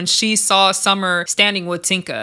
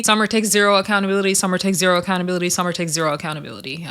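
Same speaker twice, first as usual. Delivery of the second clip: rapid-fire repetition; 3 semitones lower, much faster than usual. Real, not acted. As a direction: rattle it off, fading with each repeat. Exasperated repeated point about Summer.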